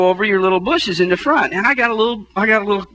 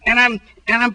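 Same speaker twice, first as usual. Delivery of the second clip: usual speaking voice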